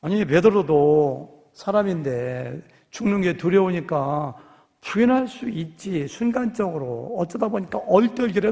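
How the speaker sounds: ordinary talk, nothing marked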